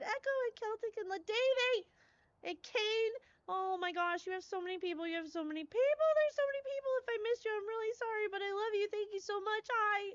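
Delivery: high-pitched, thrilled gushing; real squeaky delight; overwhelmed excitement. Real, not acted. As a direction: greet the flood of guests in giddy disbelief. Overwhelmed greeting of many raiders.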